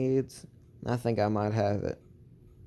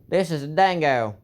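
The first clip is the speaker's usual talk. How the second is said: "This is a dingo" called out higher and louder, like a pitchman; showman announcer voice; 6 semitones higher, 5 dB louder than usual. Shown as a demonstration. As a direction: sell the dingo loud and high like a TV pitch. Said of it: "I like playing around with my voice".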